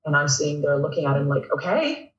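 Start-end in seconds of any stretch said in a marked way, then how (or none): 1.5-2.1 higher, rising "okay"; unsure okay. Retold or imitated. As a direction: accept it with a questioning lift. Retells unsure reaction to coach.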